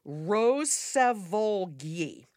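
very slow, word by word; slowly sounded out. Shown as a demonstration. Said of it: "say her last name backward".

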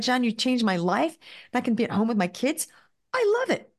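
imitating a thrilled, grateful client; grateful excitement. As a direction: gush thanks, pitch leaping at the end. Grateful client's thanks, acted out.

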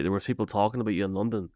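normal talking voice

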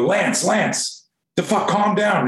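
high-pitched exasperated appeal; retold urgent plea. Hands up, to the classmate running at him, urgent. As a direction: try to call off an attacker, reenacted.

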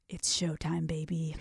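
low, hushed self pep talk; low-key psych-up. Retold or imitated. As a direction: murmur it to yourself, soft and low. Psyching self up before an act.

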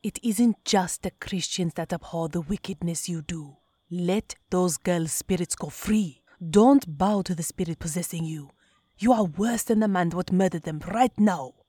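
put-on loud, forceful scolding of a wayward child; an elder's booming rebuke; stern warning. A narrator's reading. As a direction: warn her hard, voice ringing. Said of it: "he cautioned".

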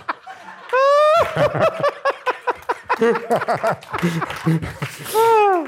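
long high-pitched laughing fit; helpless high laughter. Real, not acted. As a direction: cackle on and on, high. Laughing at own story.

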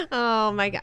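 laughing out Oh my God; amused laughing exclamation; real laugh. Real, not acted. Guest laughing at story.

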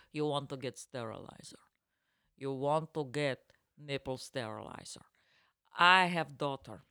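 put-on emphatic lecture in a thick Russian accent; heavy Russian accent. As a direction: imitate a stern Russian shop clerk. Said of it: "she has a heavy Russian accent".

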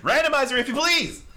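booming game show host summons; grand announcer call. Acted. Host calls the randomizer.

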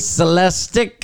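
teasing, stretched-out mimicry; mocking repetition. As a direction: draw the botched word out incredulously. Mockingly repeats the other's slip.

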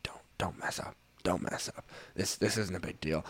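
retold yelling in a soft half-whisper; hushed mock yell. Teammates razzing a player on camera, hushed mock yell. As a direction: imitate teammates yelling, but softly under the breath. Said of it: "just, like, yelling at me".

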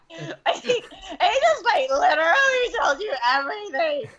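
half-laughing, half-crying exclamation; laughing mock outrage. She relives telling the girls her story, laughing high exclamation.

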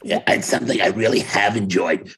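ordinary talk, nothing marked

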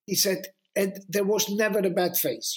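normal talking voice

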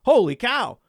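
sudden astonished double take; surprised realization. Acted. Host scanning the free agent list, suddenly astonished. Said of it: "you're like".

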